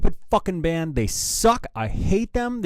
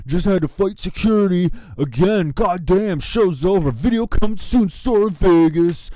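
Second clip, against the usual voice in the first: loud, higher-pitched douchebag voice; mocking singer impression; 4 semitones higher, 6 dB louder than usual. Host reading the singer's Twitter post, loud mocking impression. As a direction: imitate his tweet in a loud put-on voice. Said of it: "'Cause that's how he talks".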